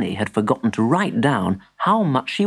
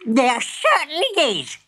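shrieking granny; 12 semitones higher than usual. A narrator's reading. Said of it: "she shrieked".

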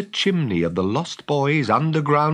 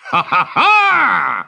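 loud, high, slow cackle; evil villain laugh; 9 semitones higher, 9 dB louder, much slower than usual. Acted. Hook gloats over his plan to the pirates, evil laugh.

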